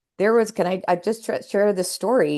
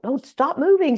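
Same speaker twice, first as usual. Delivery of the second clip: mimicking the doctor; 9 semitones higher, 3 dB louder than usual. Doctor voiced in her story.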